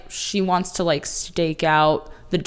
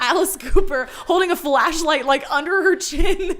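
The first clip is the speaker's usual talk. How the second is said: laughing; 8 semitones higher than usual. Real, not acted. Laughing while retelling.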